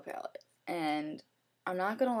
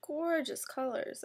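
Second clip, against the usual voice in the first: gushing; 7 semitones higher, 4 dB quieter, slower than usual. Real, not acted. Gushing over palette colours.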